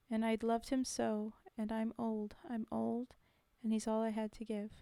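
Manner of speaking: measured, lilting poem recital; poem reading. Acted. Streamer reciting a war poem to chat, measured. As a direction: read it like verse to an audience.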